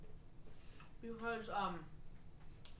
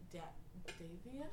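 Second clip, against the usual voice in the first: ordinary talk, nothing marked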